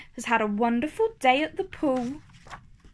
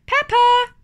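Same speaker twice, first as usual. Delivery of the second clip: high, loud mummy calling out; Mummy Pig calling; 4 semitones higher, 5 dB louder than usual. Acted. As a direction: call the piglets to the poolside. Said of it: "snorts Mummy Pig".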